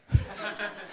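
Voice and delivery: short laugh. Real, not acted. Laugh after the punchline.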